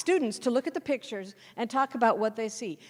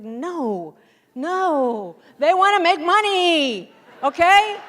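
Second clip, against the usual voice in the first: restaurant manager; 6 semitones higher, 11 dB louder, much slower than usual. Retold or imitated. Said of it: "And he's like".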